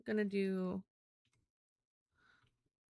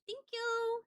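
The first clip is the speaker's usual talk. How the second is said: high sing-song thanks. Real, not acted. Streamer thanking a viewer for a tip, high and chirpy. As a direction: thank you, squeaked up high.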